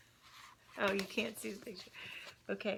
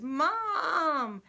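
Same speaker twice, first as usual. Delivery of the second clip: high, loud whining call for Mom; little brother whining to Mom for help; whiny little brother; 8 semitones higher, 6 dB louder than usual. Acted. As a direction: wail for Mom like a tattling kid. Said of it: "in this really whiny brother way".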